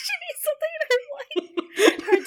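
high squeal through laughter; cracking up. Real, not acted. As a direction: repeat it while laughing hard, voice way up. Repeats friend's line while cracking up.

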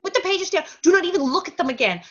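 lightly acted emphatic instruction; retold urgent order. Father forbidding another look at the pages, insistent.

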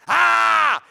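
sudden loud, high shriek; demon scream. Acted. Demon interrupting praise, sudden high scream. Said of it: "until a demon goes".

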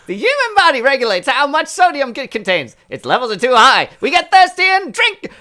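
put-on high, bright transatlantic accent; glamorous old Hollywood star. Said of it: "A transatlantic accent".

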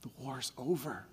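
put-on a young man hushing the news; stunned hush. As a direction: say it barely believing it. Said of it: "he goes".

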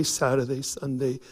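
normal talking voice

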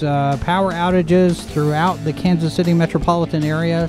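ordinary talk, nothing marked

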